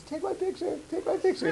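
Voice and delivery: high sing-song call; a lady calls from her house begging the traveler to take her picture, high and sing-song. Retold or imitated. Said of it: "this lady's calling to me".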